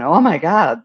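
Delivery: loud, swooping cry of dismay; mock alarm. Reader shows client how not to react, mock panic. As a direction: act out a startled overreaction. Voicing panic at a big change.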